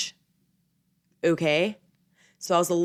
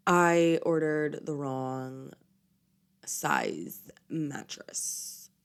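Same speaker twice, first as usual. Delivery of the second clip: slow whisper, fading out; whispered confession; 3 semitones lower, 4 dB quieter, much slower than usual. Real, not acted. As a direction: whisper it like a guilty secret. Confessing embarrassing mistake.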